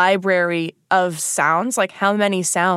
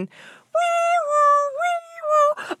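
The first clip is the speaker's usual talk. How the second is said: high wailing siren noise; much slower than usual. Pretend. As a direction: wail like a police siren. Siren imitation.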